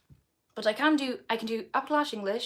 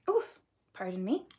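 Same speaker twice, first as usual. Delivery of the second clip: usual speaking voice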